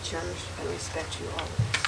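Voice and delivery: softly fed vow line; quiet prompting. Real, not acted. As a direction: murmur the words for repeating. Officiant feeds vow lines.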